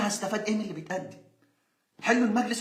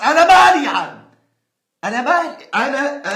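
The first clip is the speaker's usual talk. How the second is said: loud, higher, exasperated yell; exasperated shout; 6 semitones higher, 11 dB louder, slower than usual. Real, not acted. Ranting vlogger fed up with the club president, shouting. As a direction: throw the question up in disgust.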